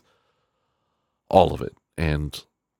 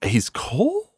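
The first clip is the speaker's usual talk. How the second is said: mock skeptic voice; 13 semitones higher than usual. Mock question from imagined listener.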